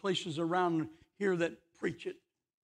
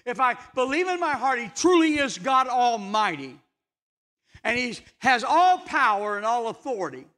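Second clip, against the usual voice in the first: high, earnest pulpit voice; preaching; 6 semitones higher, 7 dB louder than usual. Acted. Preacher urging listeners that God answers, raised and earnest. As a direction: press it home to the congregation.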